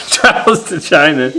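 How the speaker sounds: laughing through the words; can't hold back a laugh. Real, not acted. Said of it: "I was trying to keep a straight face".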